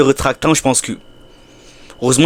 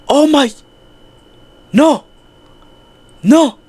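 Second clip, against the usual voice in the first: panicked shouting; 13 semitones higher, 4 dB louder, slower than usual. Real, not acted. Panicked screaming.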